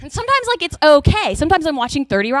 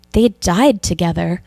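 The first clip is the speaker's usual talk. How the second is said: slow, lower, hushed awe at a movie; dad choked up; 4 semitones lower than usual. Acted. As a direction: savor each word, moved. Dad moved by sad film ending.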